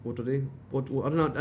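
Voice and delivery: ordinary talk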